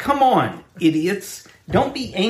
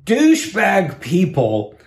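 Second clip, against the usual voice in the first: slow, louder, punched insult; emphatic insult; 4 semitones higher, 4 dB louder than usual. Real, not acted. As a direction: spit it out deliberately. Insult after long pause.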